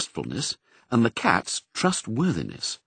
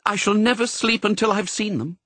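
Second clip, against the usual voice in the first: anxious mother; 10 semitones higher than usual. Acted.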